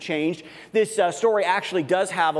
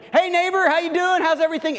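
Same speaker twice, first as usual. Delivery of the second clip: much higher, louder friendly hail across the yard; cheery call out; 12 semitones higher, 3 dB louder than usual. Acted. Walking the dog, calling cheerily to the neighbor in the yard.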